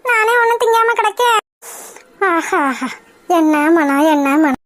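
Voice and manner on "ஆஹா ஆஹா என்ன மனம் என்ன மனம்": savoring the aroma. Acted. Uma relishing the curry's smell, low and delighted.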